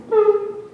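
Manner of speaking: high, short vocalised boop; intercom beep. Retold or imitated. Imitating the school intercom before an alert, high electronic boop.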